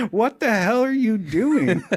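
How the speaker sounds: shocked doctor. Retold or imitated. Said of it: "he says".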